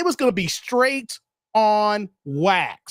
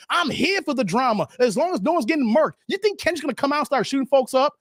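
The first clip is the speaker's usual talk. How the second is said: ordinary talk, nothing marked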